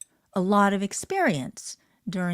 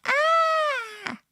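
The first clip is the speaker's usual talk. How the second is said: put-on very high cry of a distressed baby; colicky baby crying out, very high; baby's wail; 5 dB louder than usual. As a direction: let out a baby's pained wail.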